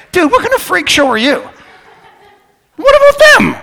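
high, loud, fast indignant yell; retold argument building to a full shout; escalating outburst. Driver blowing up at the ticket guy, escalating outburst. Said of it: "so I escalate him".

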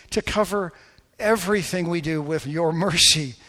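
rising, emphatic pulpit prayer that fades to a hush; fervent prayer. Acted. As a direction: pray over the congregation with growing fervour. Pastor leads closing prayer.

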